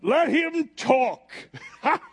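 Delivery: drawn-out mocking call from the crowd. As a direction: jeer like the scoffing court. Mocking crowd around Paul.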